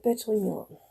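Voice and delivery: lightly voiced cat who wants to be left alone; a hint of a sleepy, annoyed cat; grumpy cat voice. Voicing her sleeping cat telling her off, lightly grumpy. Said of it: "He's like".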